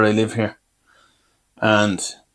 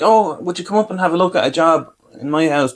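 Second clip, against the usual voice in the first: higher, lightly voiced dentist; wheedling dentist; 5 semitones higher than usual. Dentist asking her patient to look at a job, higher retold voice. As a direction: pitch it up to ask a favour.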